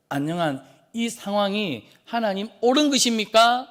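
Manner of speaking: high, forceful rhetorical appeal; indignant protest. Acted. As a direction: question God's fairness as a protesting angel. Acting angel's protest to God.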